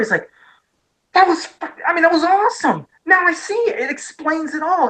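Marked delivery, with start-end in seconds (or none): 1.1-4.9 high-pitched, thrilled reaction; retold excitement. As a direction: relive the amazed moment of discovery. Retold excited reaction to bootleg film.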